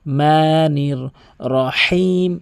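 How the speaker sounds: "রহিম" with a throaty h and a long drawn-out ee; correct Qur'anic recitation. Shown as a demonstration. Qur'an teacher showing the correct reading, slow careful recitation. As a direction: recite "রহিম" slowly, stretching the final vowel. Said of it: "আর বলতে হবে".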